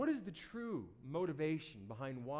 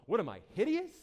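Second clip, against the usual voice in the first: louder, higher wounded cry; mock indignant outburst; 4 semitones higher, 8 dB louder than usual. Poster with no likes, to themselves: mock indignant outburst. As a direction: blurt it out in fake outrage. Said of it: "And you go".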